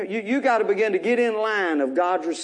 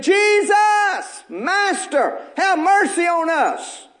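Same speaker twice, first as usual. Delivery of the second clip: high pleading cry, drawn-out mercy; a hint of desperate lepers calling from afar; 8 semitones higher, much slower than usual. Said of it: "they lifted up their voices and they said".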